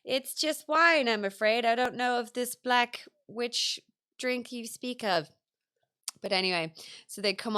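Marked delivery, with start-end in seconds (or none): 0.0-5.3 olde-worlde castle maid. Retold or imitated. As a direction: ham up the quaint lady of the castle. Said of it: "or it's like".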